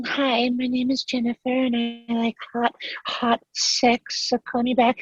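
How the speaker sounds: high, breathy-free put-on woman's voice; fake girl voice. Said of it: "I would call as a girl".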